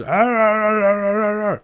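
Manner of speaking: long, high, loud wordless wail; imitated deaf man's vocalizing. Deaf man trying to get her attention, imitated loud wail. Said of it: "what the fuck he sounds like".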